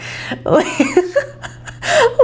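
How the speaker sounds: high, helpless laughter; high-pitched laugh trailing on; real giggling fit. Real, not acted. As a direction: keep cracking up, unable to resume. Still laughing at own word.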